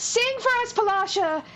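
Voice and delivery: high-pitched fan plea; imitated audience calling to the singer; crowd calling out. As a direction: beg like an eager crowd. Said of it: "everyone starts being like".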